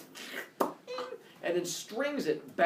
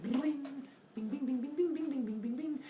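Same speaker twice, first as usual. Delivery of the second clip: high sung bing bing of a lyre; sung lyre plinks; 11 semitones higher, 4 dB quieter than usual. Retold or imitated. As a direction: sing the strumming of a lyre. Imitating lyre strumming.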